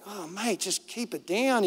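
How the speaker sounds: soft, sheepish aside; embarrassed hushing. Retold or imitated. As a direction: quietly urge an overkeen mate to pipe down. Retold embarrassed plea to hush.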